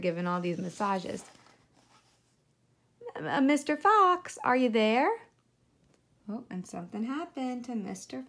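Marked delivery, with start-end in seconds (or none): 3.1-5.1 high little pig voice calling; puzzled piglet. Acted. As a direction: check whether the fox is still there. Piglet voice in story.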